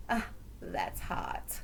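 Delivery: very low, slow, whispery catchphrase impression; daughter's catchphrase. Host quotes Kathy's daughter, soft low catchphrase impression. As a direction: imitate her in a soft, drawn-out low murmur. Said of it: "What would your daughter say".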